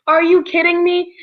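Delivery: normal talking voice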